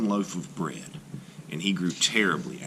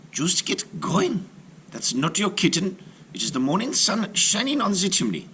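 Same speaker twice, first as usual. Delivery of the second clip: impatient mother; 12 semitones higher than usual. A narrator's reading. Mother scolds Hansel on the path, sharp and high. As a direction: nag the boy to keep walking.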